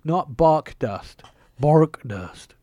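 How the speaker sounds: loud, very slow contrast on "bark"; over-enunciate "bark dust" for someone who misheard; tailored accent. Retold or imitated. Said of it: "had to tailor my accent".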